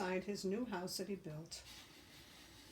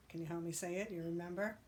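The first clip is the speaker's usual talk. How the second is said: ordinary delivery, nothing marked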